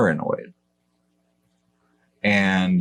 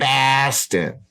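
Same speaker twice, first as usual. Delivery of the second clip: higher, hammed-up Boston accent; 4 semitones higher than usual. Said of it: "That's my Boston accent".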